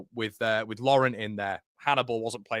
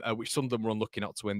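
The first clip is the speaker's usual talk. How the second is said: normal talking voice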